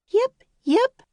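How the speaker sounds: very high, loud puppy yips. Acted. The lost puppy answering from the woods. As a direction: bark like a tiny dog.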